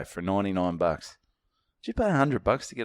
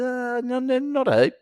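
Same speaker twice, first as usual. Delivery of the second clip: sheepish high reply; 13 semitones higher than usual. Retold or imitated. Comedian to the promoter, admitting few followers, in a sheepish high voice. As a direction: own answer squeaked out much higher. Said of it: "I said".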